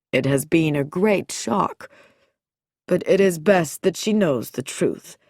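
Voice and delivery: lightly voiced, deliberate doctor; a hint of a grave, controlled medical man; measured doctor. Doctor Nicholson explains Sylvia's shock to Frankie, lightly voiced measured doctor.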